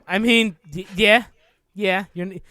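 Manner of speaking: bad mock Canadian accent, lightly done; a joke Canadian twang; hammed-up fake Canadian; terrible Canadian accent. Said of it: "That was a, that was a terrible Canadian accent".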